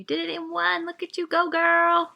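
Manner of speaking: high, bright-pitched cheer; hype cheer. Real, not acted. Praising her co-host for finishing the story, gushing high cheer. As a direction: cheer her on like a proud friend.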